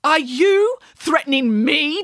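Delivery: slow, high, incredulous outburst; outraged demand. A narrator's reading. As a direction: punch out each word in outrage. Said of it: "his voice reaching new extremes of loudness".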